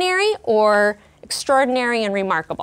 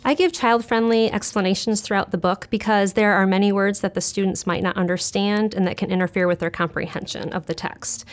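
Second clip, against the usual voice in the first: ordinary talk, nothing marked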